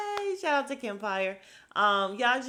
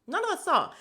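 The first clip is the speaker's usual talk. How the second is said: higher, louder sarcastic repeat; sarcastic echo; 10 semitones higher, 5 dB louder than usual. Real, not acted. Mocking the cast's claim, higher sarcastic echo. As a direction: throw it back mockingly.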